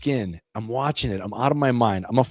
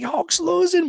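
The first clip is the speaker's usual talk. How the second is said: put-on upset kid; much slower than usual. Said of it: "I'm like".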